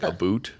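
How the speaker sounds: "about" said as "a boot"; imitated "about". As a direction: quote "about" with an oo vowel. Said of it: "Didn't he say, like".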